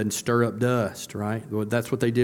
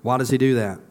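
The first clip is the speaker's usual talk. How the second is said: ordinary talk, nothing marked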